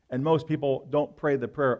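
ordinary delivery

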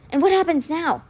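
a touch of worry creeping in; fearful. A narrator's reading. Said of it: "His voice grew fearful".

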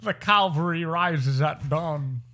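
high, slow heroic proclamation; grand dramatic actor. Acted. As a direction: declaim it slowly like a period drama herald. Acts grand period drama line.

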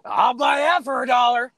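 TV ad guy. Retold or imitated. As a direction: punch it like a cheesy TV pitchman. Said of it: "show a guy go".

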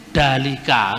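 "Dalika" said low, the Balinese way of saying the Arabic D; Balinese accent. Shown as a demonstration. Lecturer imitating how Balinese people say Arabic letters, Balinese accent. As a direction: drop the voice and say "Dalika" like a Balinese speaker. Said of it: "kan orang Bali ngomong".

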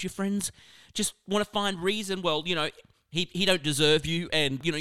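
ordinary talk

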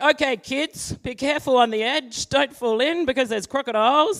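a hint of a cautious father calling out; mock dad warning the kids at the edge; dad's warning. Dad warns kids by the water.

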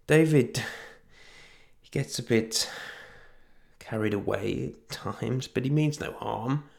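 put-on timid young woman. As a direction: make excuses meekly. Juliet excuses the thug.

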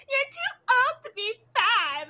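put-on high child's retort; imitated little girl. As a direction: mimic a small girl correcting a grown-up. Voices the little girl's retort.

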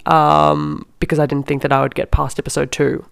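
ordinary talk

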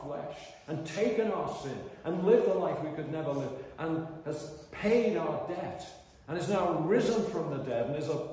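impassioned pulpit crescendo; building preaching. Real, not acted. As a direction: build to a triumphant proclamation. Preaches at full force.

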